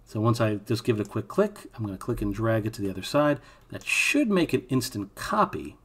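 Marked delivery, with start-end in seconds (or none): 3.7-5.7 "should" stretched out and lifted; stress on "should". Real, not acted. Tutorial host warning the copy may fail, stress on "should". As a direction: lean slowly on "should" as a caveat. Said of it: "Emphasis on the word should".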